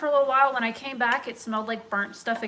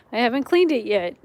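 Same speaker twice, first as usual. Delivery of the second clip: ordinary delivery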